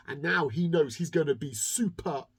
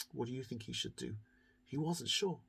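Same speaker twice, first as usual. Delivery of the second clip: plain reading voice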